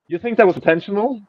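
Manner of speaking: ordinary delivery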